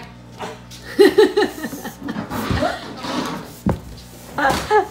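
long, high, airy laugh; real laugh. Real, not acted. Laughing over a big appetite, long real laugh. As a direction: laugh on and on, mostly breath. Laughs at pet's love of food.

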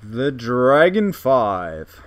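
slow, loud, booming announcer-style deep voice; mock deep voice. Gamer hamming up a pet's name, mock deep voice. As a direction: draw it out grandly in a put-on deep voice. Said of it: "talk in a deep voice like that".

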